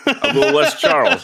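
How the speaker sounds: high-pitched teasing singsong; high silly voice. Pretend. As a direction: toss it off in a squeaky tease. Bandmate mocks host's name.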